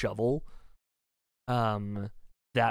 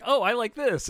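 high, louder delighted exclamation; pitched-up, pleased little outburst; pleased discovery; 12 semitones higher, 5 dB louder than usual. Acted. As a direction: act out a character happily finding something they like. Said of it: "and he's like".

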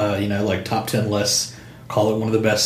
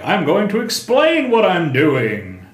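slow, hammed-up comic hero; mock comic book hero voice; 6 semitones higher than usual. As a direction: imitate stilted old comic dialogue. Mocking old comic dialogue.

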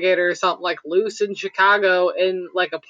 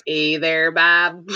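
lightly imitated Midwestern accent, a bit lower; Hyde's Wisconsin accent; 4 semitones lower, much slower than usual. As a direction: quote it in a mild Wisconsin accent. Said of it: "where Hyde's like".

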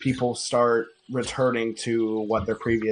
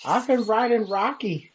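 put-on little boy voice; 11 semitones higher than usual.

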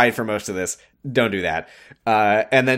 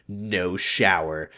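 slow, low, softer villainous refusal; mock evil; 5 semitones lower, 5 dB quieter, much slower than usual. Retelling Adrian refusing Grace's shower, mock evil. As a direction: draw it out with quiet menace. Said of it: "Adrian makes evil face and goes".

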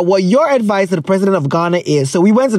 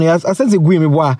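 ordinary talk, nothing marked

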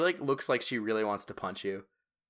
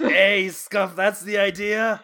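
high, loud, drawn-out call; raised-voice jeer at a companion; loud interjection; 8 semitones higher, 9 dB louder, slower than usual. Acted. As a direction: call out slowly over the uproar. Game master cuts in over uproar.

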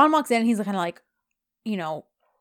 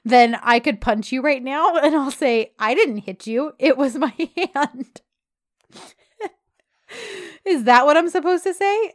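louder, higher scoffing retort, retold; mock sarcastic; 6 semitones higher, 5 dB louder than usual. John mocks Yeet's excuse about his car, sarcastic. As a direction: push the absurd comparison with amusement. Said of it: "John is so sarcastic".

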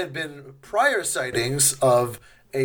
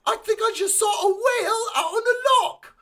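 much higher, laughing shouted squeal; mock excited local. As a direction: yell the sighting like a giddy villager. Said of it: "They're like".

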